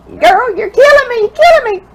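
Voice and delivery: high, loud, overcome outcry; real teary-eyed. Real, not acted. Reader to the card's writer, tearfully overcome. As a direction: cry it out, overwhelmed and tearful. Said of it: "getting too teary-eyed to read".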